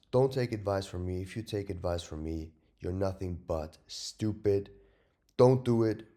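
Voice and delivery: loud, emphatic warning; loud, important tone. Real, not acted. Trader warning viewers off copying him, loud and important. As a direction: drive the warning home, loudly. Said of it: "I say it so loudly and so, um, with such an important tone of voice".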